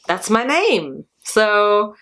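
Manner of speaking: ordinary talk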